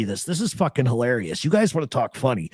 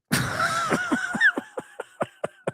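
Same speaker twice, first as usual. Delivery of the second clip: high-pitched burst of real laughter; helpless laugh; 4 dB quieter than usual. Real, not acted. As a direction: crack up at the absurdity, high and helpless. Reacting to absurd quoted line.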